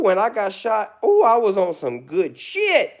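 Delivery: usual speaking voice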